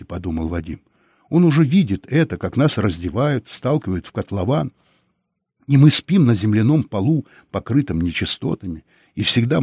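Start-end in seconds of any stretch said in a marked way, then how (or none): none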